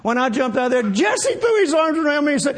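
ordinary talk

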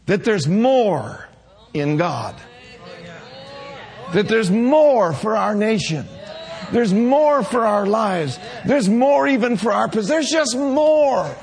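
building to a shouted climax; preaching. Real, not acted. Preaching builds to full force.

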